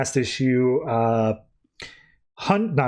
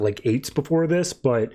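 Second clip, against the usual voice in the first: normal talking voice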